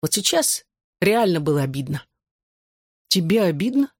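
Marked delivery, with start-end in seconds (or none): 3.1-3.9 mildly incredulous question, rising; surprised. A narrator's reading. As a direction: ask with a touch of disbelief. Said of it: "удивился я". (удивлённо)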